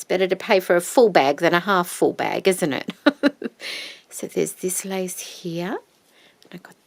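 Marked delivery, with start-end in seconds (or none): 2.9-4.0 laugh. Real, not acted. Laughing at her big order.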